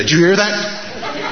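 high, slow, imitated wife; drawn-out, high-pitched question as the listening wife; wife's alert question. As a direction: mimic a wife catching a faint sound. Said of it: "my wife would say".

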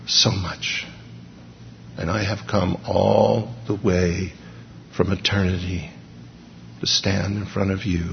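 hushed, intimate love, almost a murmur; loving voice. Acted. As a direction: speak like God cradling a blind man. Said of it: "understood the tone".

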